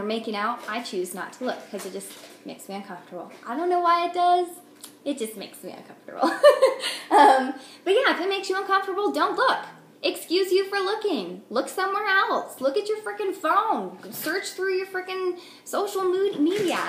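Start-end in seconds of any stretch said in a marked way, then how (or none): 3.5-6.3 high, loud and laughing throughout; excited rant. Real, not acted. Vlogger mom tells onlookers to look away, higher and louder. 6.4-7.0 airy squeal of a laugh; high breathy laugh. Real, not acted. Mom laughs at herself mid-rant, high breathy giggle. 7.1-16.8 high, loud and laughing throughout; excited rant. Real, not acted. Vlogger mom tells onlookers to look away, higher and louder.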